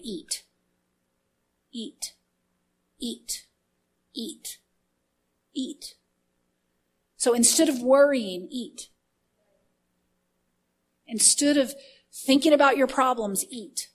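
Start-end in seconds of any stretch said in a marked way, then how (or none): 0.0-5.9 very soft repeated command; hushed. Retold or imitated. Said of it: "He's just saying". 8.5-8.9 hushed. Acted. Soft punchline command. 13.5-13.9 hushed. Acted. Soft punchline command.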